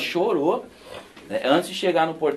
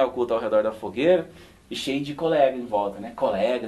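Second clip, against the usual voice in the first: ordinary delivery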